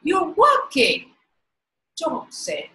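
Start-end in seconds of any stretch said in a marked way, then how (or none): 0.0-1.1 higher, louder, amazed exclamation; storyteller's surprised boy; great surprise. A narrator's reading. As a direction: cry it out as if you can't believe it. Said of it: "he cried in great surprise".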